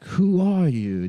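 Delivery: put-on slow, dazed old man voice; slow confused grandpa asking who you are. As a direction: drag it out like a lost old man. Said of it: "he's like".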